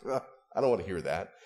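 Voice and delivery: low, quick imitated brush-off; mock a friend refusing advice with an ugh; dismissive distaste. Preacher imitating friend told not to buy car, dismissive. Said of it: "what my friend did when I said".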